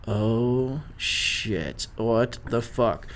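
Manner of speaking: low, slow sinking dread; hushed disbelief. Retold or imitated. Friends realising snakes are loose, hushed disbelief. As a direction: drop it low and drag it out.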